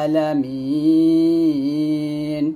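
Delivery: আলামিন drawn out with no hamza glottal stop; slow chanted আলামিন without a hamza break; correct pronunciation. Shown as a demonstration. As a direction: glide straight into আলামিন, no catch before the vowel. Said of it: "বলতে হবে".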